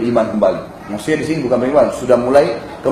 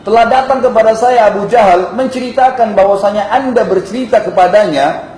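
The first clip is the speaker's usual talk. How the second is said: high, raised-voice public announcement; declaring in a shout; 7 semitones higher, 4 dB louder than usual. Retold or imitated. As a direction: proclaim it so all the bystanders hear. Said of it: "sambil teriak". (mengumumkan sambil berseru dengan nada tinggi)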